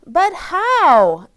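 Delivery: high, loud pleading question; urgent. Acted. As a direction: shout over the noise. Rosie anxious over noise.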